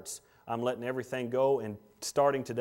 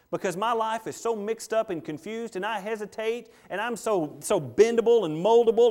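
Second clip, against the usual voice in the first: rising fervor; 7 semitones higher, 4 dB louder than usual. Acted. Preacher voicing a wavering believer.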